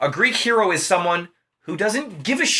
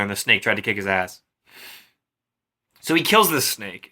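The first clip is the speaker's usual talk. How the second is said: normal talking voice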